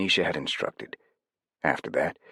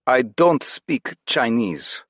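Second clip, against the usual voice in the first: put-on terrible German accent, slow and higher; bad German accent; 8 semitones higher, 5 dB louder, much slower than usual. Jed to the Chinese guards in a bad German accent. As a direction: fake a clumsy German accent. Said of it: "the worst German accent ever".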